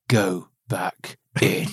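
low, slow, stern command; low grumbling order from the father; gruff order. Pretend. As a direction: send her back in, grumbling. Vasily ordering wife back.

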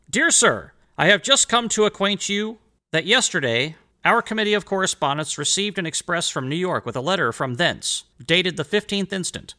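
put-on lifted, formal reading of an old letter. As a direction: read it out like a historical document. Reads letter to Elbridge Gerry.

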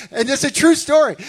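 laughing loudly through the words. Real, not acted. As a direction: laugh it out, amazed at the story. Laughing after story.

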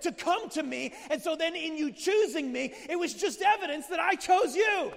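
voicing Jesus at full shout, rising high; loud preaching. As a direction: preach it out, booming and building.

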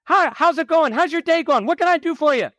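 high chirpy Dutch Brothers server greeting a customer; perky barista. Retold or imitated. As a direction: gush like an over-eager coffee barista. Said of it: "they're like".

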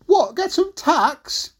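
slow, high baffled call; mishearing mum. Acted. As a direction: shout back a puzzled question. Mum mishears Jack a second time.

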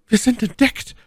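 high-pitched cry of alarm; alarmed Milady. A narrator's reading. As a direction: voice the lady's panic, pitched far up. Milady fears discovery. (erschrockene, hohe Frauenstimme)

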